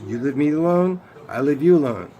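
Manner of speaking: emphatic loud rule. Real, not acted. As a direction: lay down the rule forcefully, stressing alone and you. Stating his rule, rising emphasis.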